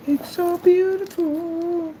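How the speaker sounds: high, slow, breathless awe; awestruck. Real, not acted. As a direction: marvel at it like a treasure. Gasping in awe at the lens.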